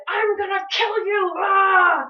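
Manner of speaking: slow, high, loud death threat that breaks into a yell; furious scream. Retold or imitated. Livid boat owner screams at dad after the bump. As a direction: scream it at the man who bumped your boat. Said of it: "screaming, he was livid".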